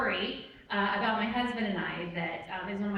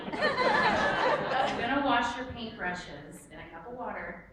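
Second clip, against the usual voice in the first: usual speaking voice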